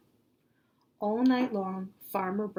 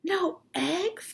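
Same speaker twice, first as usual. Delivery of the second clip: higher, rising cry of shock; crying out in disbelief; 10 semitones higher, 5 dB louder than usual. Acted. Farmer Brown hears there are no eggs, crying out in shock. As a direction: gasp it out, stunned. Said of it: "cried Farmer Brown".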